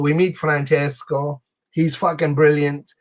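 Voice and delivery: usual speaking voice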